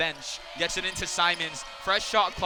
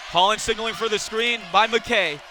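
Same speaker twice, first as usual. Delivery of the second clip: ordinary talk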